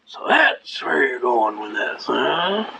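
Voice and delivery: gasp, then a slow, low, dumbstruck "huh"; mock Homer Simpson caught out. Judge caught out by the defendant, doing a mild Homer Simpson gasp. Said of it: "The judge did a Homer Simpson".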